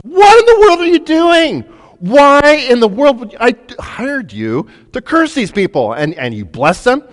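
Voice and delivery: high, loud, exasperated yelling; a king who paid for a curse and got a blessing; outraged king. Acted. King Balak yelling at the prophet he hired.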